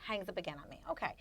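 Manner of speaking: ordinary talk, nothing marked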